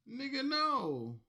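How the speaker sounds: slow, loud, high flat no; emphatic refusal. Acted. Business owner refusing a homie discount, slow loud no. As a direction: drag it out and shut it down.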